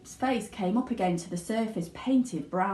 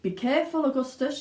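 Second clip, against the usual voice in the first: higher, louder warning; father shouting; 6 semitones higher, 3 dB louder than usual. A narrator's reading. Father warning his son, raised. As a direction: warn him sharply. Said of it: "shouted Mister Gloop".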